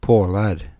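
loud, lamenting groan in dialect; old servant groaning out his pity. A narrator's reading. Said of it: "he added with a groan".

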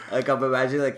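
ordinary talk, nothing marked

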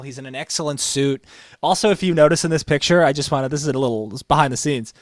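normal talking voice

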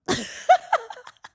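high-pitched giggle; tickled, squealing laugh. Real, not acted. Laughs at her water joke.

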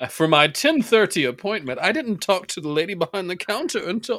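high, pinched mock of a fussy customer; mocking whiny complaint. Friend parodies the storyteller's complaint, high and prissy.

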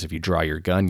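normal talking voice

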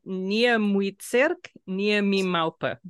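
slow, careful Polish: "nie mój cyrk, nie mi małpy"; Polish pronunciation. Shown as a demonstration. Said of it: "Can you tell me how to say it in Polish?".

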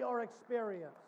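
ordinary talk

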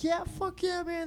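high, soft dudebro cheering; mock stoner cheer. High school kids cheering on the stoners, high and soft. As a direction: cheer like an impressed teen.

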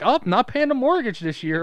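high, loud mock refusal; mock exasperation. Parent balking at the kid's icy request, mock exasperated.

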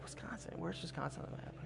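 incredulous breathy whisper of disbelief; stunned whisper. Retold or imitated. Pastor, hearing of a Wisconsin job, whispers in disbelief. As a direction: whisper it, unable to believe the news. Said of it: "Immediately I was like".

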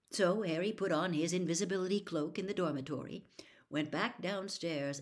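usual speaking voice

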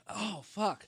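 pained outburst. Real, not acted. Suffering chip heat.

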